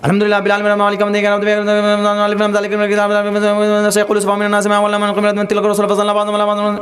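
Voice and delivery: Fatiha mock-gabbled in one breath, words blurred; one-breath rushed recitation. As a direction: imitate the hafiz racing six paras an hour. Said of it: "ایک سانس میں سورہ فاتحہ". (ایک سانس میں تیز تیز گڈمڈ تلاوت)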